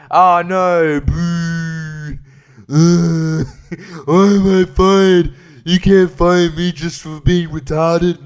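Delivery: self-mocking dopey character voice; mocking put-on voice. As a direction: play a dim-witted version of yourself.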